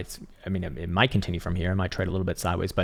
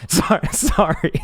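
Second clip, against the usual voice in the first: higher, louder, laughing sorry; laughing apology; 9 semitones higher, 7 dB louder, slower than usual. Real, not acted. Streamer apologising for his own bad joke, laughing.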